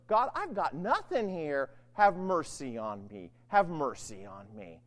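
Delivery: drawn-out, dropping prayer for mercy; crying out helplessly. Acted. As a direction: beg slowly with nothing left. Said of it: "crying out over and over".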